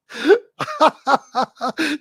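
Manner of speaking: high-pitched burst of giggling cackles; derisive laughter. Real, not acted. Streamer cannot take his rival seriously, high derisive laugh. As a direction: crack up helplessly.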